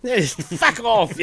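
high-pitched imitation Irish brush-off; Irish bartender. Imitating the bartender's answer to a paying customer, Irish accent. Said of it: "it's hilarious with the accent".

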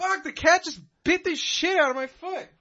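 high, shaken cry after a bite; pained outburst. Real, not acted. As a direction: blurt it out in real pain. Just bitten by the cat.

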